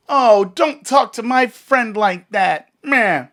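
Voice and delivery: high, loud, slow whiny mimicry of the lady; mocking whiny lady. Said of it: "comes up and does the".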